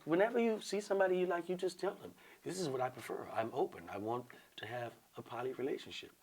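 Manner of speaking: hushed, easygoing advice; soft-spoken woman. Retold or imitated. Woman coaching him on asking, real soft. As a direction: coach him very gently and quietly. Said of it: "Real soft".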